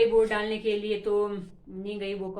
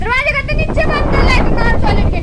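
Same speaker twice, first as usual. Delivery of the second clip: high, loud yelled order; real shout; 12 semitones higher, 14 dB louder than usual. Real, not acted. Telling someone to shut the door in wind, high shout. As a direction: yell it high over the wind.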